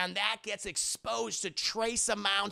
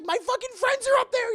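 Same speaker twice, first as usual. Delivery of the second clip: much higher, louder frantic shout; panicked yell; 10 dB louder than usual. Retold or imitated. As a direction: scream it up at the tower. MJ panicking about friends.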